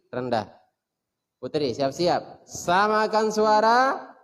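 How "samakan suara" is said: high, loud, drawn out call. Shown as a demonstration. Teacher leading pitch drill, high loud call. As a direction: reach up high for the echo.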